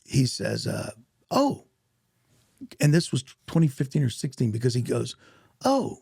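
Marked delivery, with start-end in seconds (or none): 1.3-1.7 high-pitched imitated "oh" of realization; sudden recognition. Acting out director's sudden recognition. 5.6-6.0 high-pitched imitated "oh" of realization; sudden recognition. Acting out director's sudden recognition.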